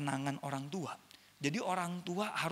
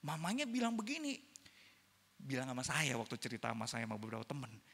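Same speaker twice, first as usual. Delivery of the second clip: ordinary talk, nothing marked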